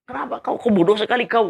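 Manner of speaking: high-pitched angry scolding; retold angry outburst, pitched high; angry boss. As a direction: imitate an outraged boss berating an employee. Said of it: "dia dengar dan dia marah".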